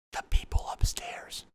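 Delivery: hushed whisper about the neighbors; secretive whisper to the listeners. Real, not acted. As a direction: whisper it so upstairs can't hear. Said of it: "I gotta kinda keep this down".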